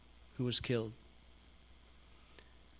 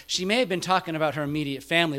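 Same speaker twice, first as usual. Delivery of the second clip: ordinary delivery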